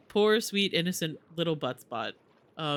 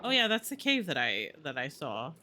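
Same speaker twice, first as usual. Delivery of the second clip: ordinary talk, nothing marked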